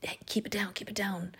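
hushing whisper. Acted. Peter hushing the excited crowd at the door, whispered. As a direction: shush them so no one hears. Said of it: "Peter's, like, trying to".

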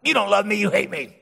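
put-on petulant child. Child sulking at the parent after a spanking, lightly voiced whine. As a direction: sulk back like a hurt little kid. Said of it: "the petulant little child might be like".

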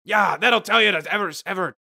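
triumphant taunt. Acted. Acted fight aftermath.

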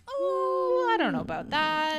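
high, loud startled squeak; taken aback. Retold or imitated. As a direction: jump way up in alarm. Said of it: "And I was like".

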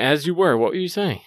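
ordinary talk, nothing marked

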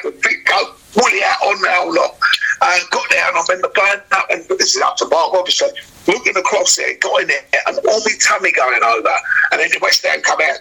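normal talking voice

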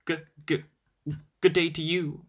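a hint of a frightened stammer; stammer, trying to sound brave; nervous stammer. A narrator's reading. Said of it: "trying not at all to sound afraid".

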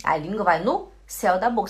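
normal talking voice

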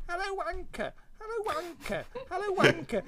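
very high falsetto call; feminine shriek. Retold or imitated. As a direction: imitate a distant shrieking woman, high-pitched. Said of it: "shriek in a vaguely feminine voice".

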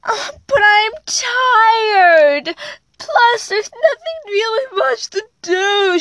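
high, slow whiny near-crying; a tired little girl whining to her mom, tearful; voice cracking. Acted. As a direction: whimper it like a tired toddler about to cry. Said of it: "her voice cracking up".

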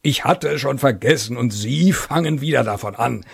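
impatient general. Acted. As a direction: brush him off impatiently. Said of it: "bewegte ungeduldig".

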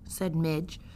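usual speaking voice

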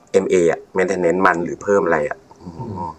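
weary near-tearful hesitation, played lightly; very sad. Real, not acted. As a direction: trail off into hesitant, sorrowful fragments. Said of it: "เสียงโคตรเศร้า".

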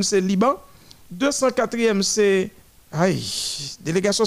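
ordinary talk

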